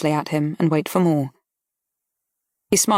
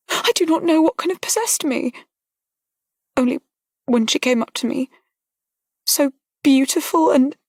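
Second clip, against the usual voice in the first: put-on higher, tearful young woman breaking down; young lady close to sobbing, higher; choked with tears; 8 semitones higher than usual. A narrator's reading. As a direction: let her crumble toward tears. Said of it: "Her utterance became choked by tears".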